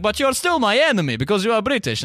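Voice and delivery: high, solemn mock Russian accent; earnest Russian man confronting a Brit; high Russian accent, serious.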